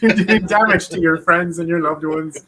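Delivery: mock intervention through real laughter; laughing. Real, not acted. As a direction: crack up while delivering the accusation. Cracking up during mock intervention.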